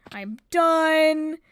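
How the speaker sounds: emphatic mock outburst of being finished; retold breaking point. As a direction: re-enact snapping, with the last word held long. Repeats it at her breaking point.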